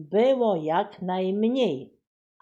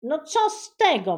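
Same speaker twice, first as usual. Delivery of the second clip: high, loud scornful yell; girl yelling at her brother; 10 semitones higher, 7 dB louder than usual. A narrator's reading. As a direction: shout him down. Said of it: "wrzasnęła Buba".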